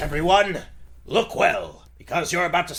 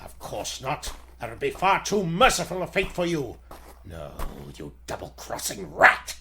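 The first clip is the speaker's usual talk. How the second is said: ordinary talk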